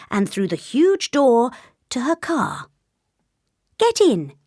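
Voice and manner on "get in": high, raised command; short high-pitched instruction; brisk order. A narrator's reading. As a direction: order them in cheerfully but firmly. Heroine orders king into car.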